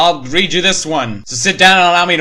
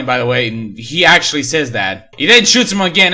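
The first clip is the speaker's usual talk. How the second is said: ordinary delivery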